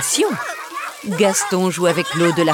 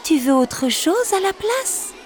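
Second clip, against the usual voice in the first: cat lady kindly offering another dish; Madame le chat offering; 12 semitones higher than usual. Acted. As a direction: gently tempt the child. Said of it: "propose Madame le chat".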